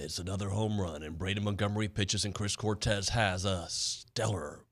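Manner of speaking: in a world, movie trailer style. Shown as a demonstration. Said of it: "uh, movie trailer voice".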